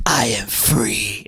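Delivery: low, slow demon-like creepy voice; super creepy. Retold or imitated. Aaron in his changed demon voice, creepy and slow. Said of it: "it's in that super creepy way".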